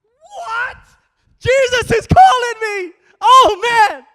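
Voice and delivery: can't believe your luck, burst out; excited disbelief. Acted. Blind man told Jesus is calling him, in a high thrilled outburst. Bartimaeus hears Jesus calls.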